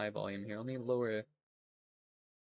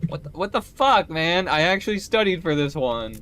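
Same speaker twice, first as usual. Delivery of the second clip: higher, louder mock-exasperated complaint; retold outburst; 6 semitones higher, 10 dB louder than usual. Student reacting to the auto-translated Spanish exam, retold outburst. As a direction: protest loudly, as if cheated by the exam.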